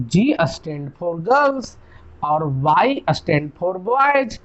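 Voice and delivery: put-on G stand for girls spelled out very slowly; slow explaining. Friend explaining guys to classmates, very slowly. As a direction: draw out each word as if teaching. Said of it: "बोला".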